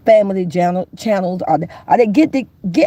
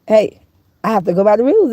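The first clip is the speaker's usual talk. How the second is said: normal talking voice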